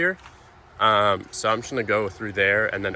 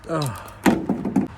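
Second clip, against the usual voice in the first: exertion grunt; 5 semitones higher than usual. Real, not acted. Straining with a heavy table.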